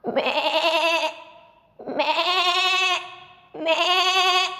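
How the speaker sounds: bleating like a goat; little rising goat bleats. Acted. Storyteller bleating as the farm goat.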